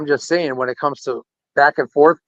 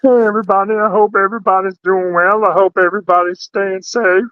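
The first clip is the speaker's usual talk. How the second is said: higher, bright streamer greeting voice; raised-pitch impression of a streamer friend; Jake Hudson imitation; 6 semitones higher than usual. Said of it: "a Jake Hudson imitation".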